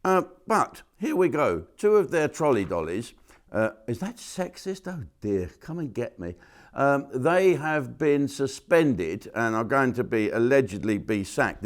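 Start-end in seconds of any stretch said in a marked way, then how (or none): none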